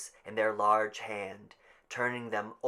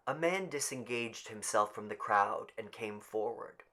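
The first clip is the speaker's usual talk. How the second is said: plain reading voice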